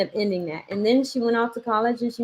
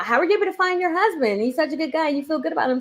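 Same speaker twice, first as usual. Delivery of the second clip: imitated amazed friends; 6 semitones higher, faster than usual. Voices daughter's friends asking in amazement.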